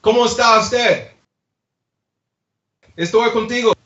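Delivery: put-on Spanish for the crowd; Spanish showpiece. Shown as a demonstration. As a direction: show off some Spanish.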